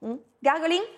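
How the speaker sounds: ordinary delivery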